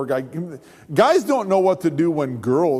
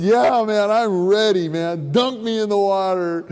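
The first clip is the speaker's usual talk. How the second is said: loud, raised-pitch enthusiasm; eager; 6 semitones higher, 4 dB louder than usual. Retold or imitated. Convert telling his pastor he's ready for baptism, gung-ho. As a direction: burst out raring to go.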